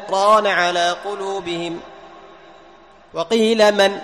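slow chanted tajweed recitation with a held pause; Quran recitation. Shown as a demonstration. Teacher reciting Quran to students to show a pause without breath, chanted. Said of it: "ما في تنفس".

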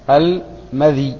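"المذي" with i after dhal and still ya; إسكان الياء. Shown as a demonstration. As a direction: say "المذي" slowly as al-ma-DHEE, single long final vowel. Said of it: "وبكسر الذال وإث-، وإسكان الياء". (نطق "المذي" بكسر الذال وإسكان الياء)

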